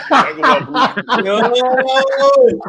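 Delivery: high, drawn-out words through laughing; real helpless laughter. Real, not acted. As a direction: crack up while trying to talk. Cracking up at his friend's joke.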